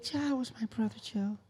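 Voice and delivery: high, soft, put-on woman's voice; Janet. Quoting Janet on her brother, high mock voice. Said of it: "And she was like".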